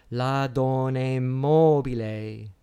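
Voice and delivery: "la donna mobile" slow and loud, MO held long; stress on third last syllable. Shown as a demonstration. Lecturer illustrates sliding line endings, heavy first-syllable stress on "mobile". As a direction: declaim "la donna mobile", leaning on MO of "mobile". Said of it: "accent's on the third last syllable".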